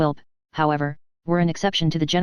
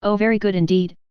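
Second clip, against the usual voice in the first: ordinary delivery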